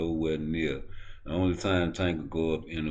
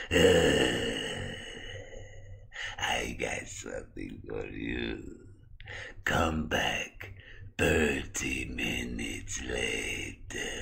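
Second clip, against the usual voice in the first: very slow, low, soft creepy voice; sinister drawn-out doctor drone; Victor; 8 semitones lower, 6 dB quieter, much slower than usual. Acted. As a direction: stretch every word out eerily. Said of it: "why do you talk like that".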